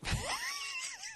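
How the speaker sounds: very high, soft squealing laugh. Real, not acted. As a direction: squeal a laugh at your own bit. Reacting to his own impression.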